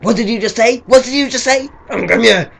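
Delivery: shouted, squared-up mock confrontation; sudden angry challenge. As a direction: go from nought to sixty in a burst of mock rage. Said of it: "like that".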